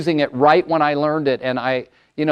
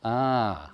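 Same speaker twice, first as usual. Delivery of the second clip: soft, low dawning "ah"; quiet aha of realization; realization sigh; 4 semitones lower, 9 dB quieter than usual. Retold or imitated. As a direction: let it dawn on you quietly. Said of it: "you're gonna go".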